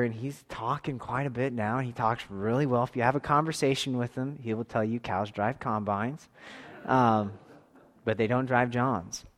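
ordinary talk, nothing marked

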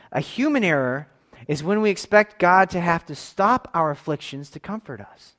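ordinary talk

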